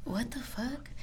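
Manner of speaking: hushed, drawn-out disbelief; slow near-whisper, retelling bewilderment; confused. Retold or imitated. Barber recalls reacting to an angry client, confused half-whisper. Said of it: "I was, like, confused".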